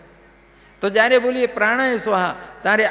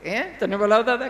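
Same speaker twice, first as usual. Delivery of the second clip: higher mother's voice rising on બોલાવતા in disbelief; surprised question; 3 semitones higher than usual. Acted. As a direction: ask the child, astonished. (નવાઈ પામેલી માનો ઊંચો સવાલ)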